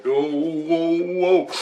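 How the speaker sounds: high startled whoas. Retold or imitated. Man retelling a dream, reliving the shock.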